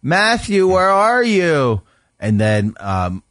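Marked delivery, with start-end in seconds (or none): 0.0-1.8 put-on high, slow sing-song call for a hiding child; calling mom. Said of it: "she would go".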